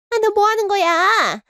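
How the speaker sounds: mother yelling as her son drags her out; shocked yell. Acted. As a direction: shriek at him as he pushes you.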